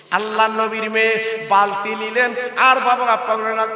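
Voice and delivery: loud chanted sermon with long drawn line endings; impassioned religious storyteller; full-force preaching. Acted. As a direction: preach it out, ringing and sing-song.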